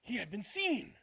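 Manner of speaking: usual speaking voice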